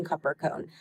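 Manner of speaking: low, soft "Cup or cone"; child's echoed response. Retold or imitated. Son answering parent's prompt, "Cup or cone" said low and soft. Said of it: "and him responding".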